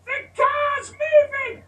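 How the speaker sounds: put-on very high, slow toddler yell; toddler screaming. Toddler screaming from the rolling car, very high. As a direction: wail it like a panicked little boy. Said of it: "He's screaming".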